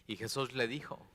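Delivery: usual speaking voice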